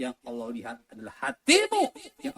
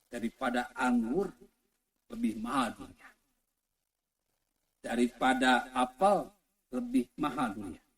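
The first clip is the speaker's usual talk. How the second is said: usual speaking voice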